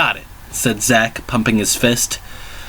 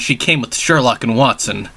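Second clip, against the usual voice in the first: plain reading voice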